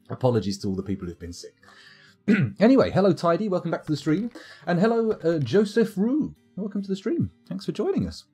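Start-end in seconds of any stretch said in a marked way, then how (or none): none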